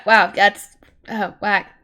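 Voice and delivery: plain reading voice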